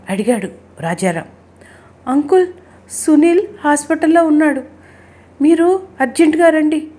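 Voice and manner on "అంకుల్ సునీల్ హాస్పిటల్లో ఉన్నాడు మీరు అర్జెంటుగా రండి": high, urgent plea with only a touch of tears; tearful, distressed. A narrator's reading. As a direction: break bad news urgently, pitched high. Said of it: "ఏడుస్తూ మాట్లాడుతున్నాడు". (కంగారుగా, కొంచెం ఏడుపు గొంతుతో)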